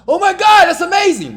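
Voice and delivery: screaming with joy, high and loud; excited shout. Retold or imitated. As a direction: yell it like your team just scored. Excited fan at a game.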